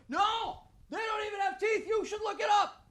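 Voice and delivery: high, slow goofy buddy voice; mock the scared friend in a high dopey voice; high drawn-out buddy impression; buddy's mocking voice.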